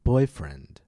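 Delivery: put-on "Boyfriend" with BOY high and loud; stress on first noun. Shown as a demonstration. Teacher leading listen and repeat, "Boyfriend" stressed on BOY. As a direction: punch "Boy", drop "friend". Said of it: "Stress the first noun".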